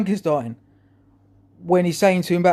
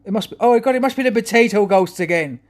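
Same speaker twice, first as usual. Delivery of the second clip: higher, lightly acted exasperated husband; mock exasperation; 6 semitones higher, 4 dB louder than usual. Husband blaming the potato ghosts to his wife, mock exasperation. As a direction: blame it with mock alarm, pitched up.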